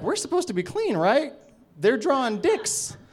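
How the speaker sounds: high-pitched, incredulous objection; retold alarmed protest. Acted alarmed complaint at the film.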